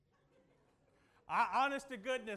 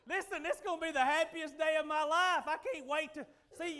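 louder, higher eager voice; mock excitement; 5 semitones higher, 6 dB louder than usual. Preacher mimicking his teenage self, giddy excitement. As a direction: gush like a kid about to leave home.

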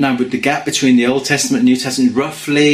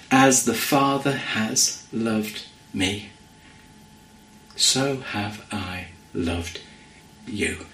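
slow, soft and tender, sinking lower toward the end; slow reverent quote; 4 semitones lower, 8 dB quieter, slower than usual. Acted. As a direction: savour each word as a precious promise. Quoting Jesus' words over music.

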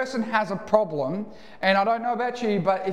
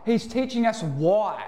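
normal talking voice

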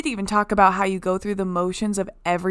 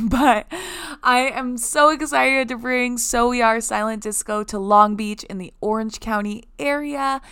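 ordinary talk

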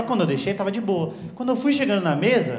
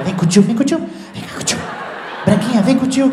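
creepy coaxing uncle; 2 semitones higher than usual. Acted. Creepy coaxing of child at party.

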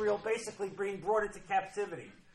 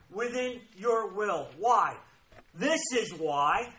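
ordinary talk

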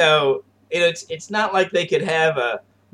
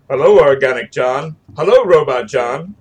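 stilted mock robot greeting; measured, lower, evenly spaced robot speech; robot duplicate; 4 semitones lower than usual. Co-host imitating duplicates greeting each other, mock robot. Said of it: "gonna make duplicates and they're gonna walk around going".

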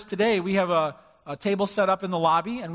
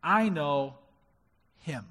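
calm confidence; 5 semitones lower, 3 dB quieter, slower than usual. Retold or imitated. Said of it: "What he said was".

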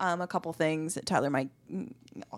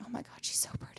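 whispered gush; 13 dB quieter, faster than usual. Retold or imitated. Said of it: "I was like".

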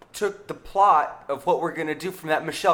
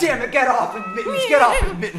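laughing mock command; 8 semitones higher, 4 dB louder than usual. Cop pulls cat off.

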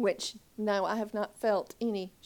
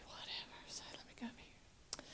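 whisper; 23 dB quieter, faster than usual. Retold or imitated. Whispered aside.

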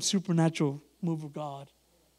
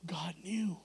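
whisper; 2 semitones lower, 10 dB quieter than usual. Real, not acted.

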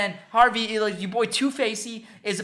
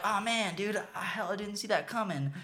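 much softer, muttered retold dismay; dejected Joker; 11 dB quieter than usual. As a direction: quietly let down, mock-Joker. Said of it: "the Joker's like".

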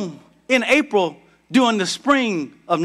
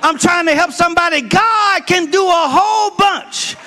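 preaching at full force; 7 semitones higher than usual. Acted. Pastor rousing the church about Ananias, full preaching shout. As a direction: whoop it up for the congregation.

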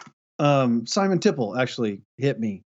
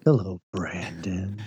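whispered slow smooth low voice; 7 semitones lower, 6 dB quieter, slower than usual. Retold or imitated.

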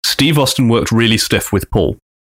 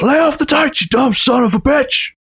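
much higher mock angry shout; 14 semitones higher than usual. Austin to Big Show in the corner, mock shout. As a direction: yell it right in his face. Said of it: "gets in his face and shouts".

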